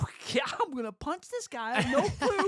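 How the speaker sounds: loud, high exasperated rant; mock irritation. Fed up with the friend's vague orders, irritated. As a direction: blurt it out, hammed-up frustration. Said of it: "And irritation".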